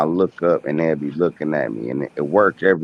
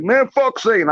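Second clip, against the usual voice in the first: sudden high-pitched dismissive jab; mock outburst. Imitating the reaction he won't have, in a high mock-dismissive voice. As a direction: fling it off in a much higher voice, mock indignant. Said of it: "I ain't gonna be like".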